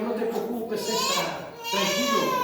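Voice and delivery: high soothing comfort to a brother; a kindly brother reassuring. Retold or imitated. Pastor voices a reassuring brother.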